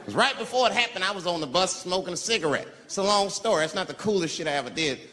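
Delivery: ordinary talk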